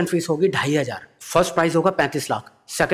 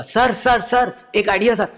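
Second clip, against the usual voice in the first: higher, urgent calling; eager rush; 9 semitones higher, slower than usual. Retold or imitated. Comedian bursting into Modi's office, eager. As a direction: burst in breathless with the idea. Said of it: "भागता भागता".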